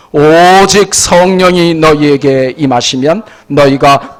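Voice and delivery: loud, measured scripture recitation; congregational scripture reading. Real, not acted. Preacher leading congregation in Acts, loud declamatory reading. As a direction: lead the verse out loud and firm.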